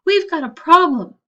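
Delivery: calling for help; yelling. Acted. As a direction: shout to be heard through the wall. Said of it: "he yelled".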